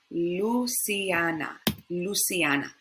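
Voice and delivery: slow, Luciana as loo-see-AH-nah; Spanish pronunciation. Shown as a demonstration. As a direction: Luciana with open A's. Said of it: "we would remember that L".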